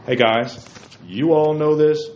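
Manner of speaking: ordinary talk, nothing marked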